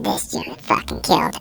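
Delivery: hushed, breathy lie into the phone; a secret boast under the breath; whispered brag. Retold or imitated. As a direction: whisper it so nobody overhears.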